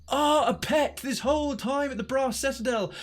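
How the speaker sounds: thrilled to find a new dog; excited. Real, not acted. Finds new pet dog.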